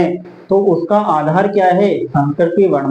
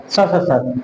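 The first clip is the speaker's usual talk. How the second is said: स, स, सर run into one word; all together; much slower than usual. Shown as a demonstration. Teacher answering how the sutra joins up, said together. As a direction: blend the sibilants and r without pauses. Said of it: "एक साथ बोलेंगे तो क्या बोलेंगे इसको?".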